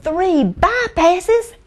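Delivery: acted shocked patient; incredulous. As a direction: question the doctor in high-pitched astonishment. Patient can't believe doctor's news.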